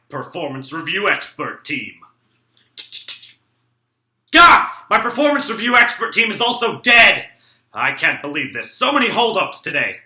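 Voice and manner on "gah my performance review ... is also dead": sudden high yelp, then a loud, higher shout; outraged shout. Acted. Dad to his son, finding his team dead: comic outraged shout.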